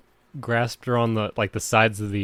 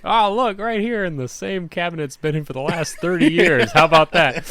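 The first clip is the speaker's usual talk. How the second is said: higher, louder mock-sarcastic dad, laughing through it; 8 semitones higher, 7 dB louder than usual. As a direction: play the dad scoffing at himself, cracking up. Dad sarcastically answering himself, laughing.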